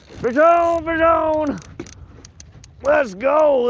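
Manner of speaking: high, loud excited yell; excited shout. Real, not acted. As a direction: call out the catch in real excitement. Hooked fish on livestream.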